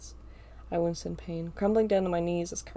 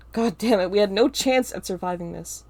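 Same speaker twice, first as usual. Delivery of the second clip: despairing curse; 7 dB louder than usual. A narrator's reading. Narrator despairs loudly.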